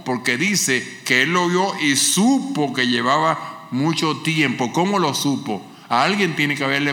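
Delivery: high, loud pulpit fervour; fervent preaching. Acted. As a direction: lift the church with every phrase. Preacher at full force. (predicación ferviente, aguda y fuerte)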